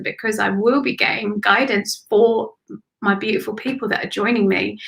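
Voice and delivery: ordinary talk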